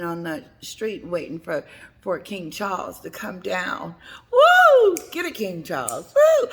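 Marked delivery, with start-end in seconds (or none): 4.3-5.3 loud, much higher whoop; cheering. Real, not acted. Cheering King Charles on from the street, loud whoop. 6.1-6.5 loud, much higher whoop; cheering. Real, not acted. Cheering King Charles on from the street, loud whoop.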